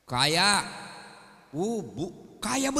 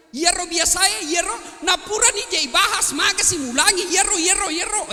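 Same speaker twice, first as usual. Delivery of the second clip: high, loud nagging rant in Banjar; nagging wife; 9 semitones higher, 4 dB louder than usual. Retold or imitated. Wife bringing up husband's old mistake, loud nagging. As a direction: scold like a fed-up wife dredging up old faults. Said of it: "kalau dia ngomel".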